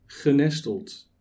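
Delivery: usual speaking voice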